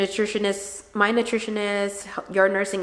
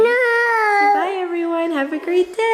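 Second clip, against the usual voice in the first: high, slow, louder sing-song; 9 semitones higher, 4 dB louder, slower than usual. Real, not acted. Prompting child to say bye.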